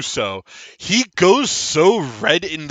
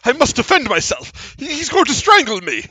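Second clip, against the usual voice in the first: louder, stammering halfling plea; pleading halfling; 9 semitones higher, 5 dB louder than usual. Acted. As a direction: beg a big stranger for protection. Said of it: "Garrus, he goes".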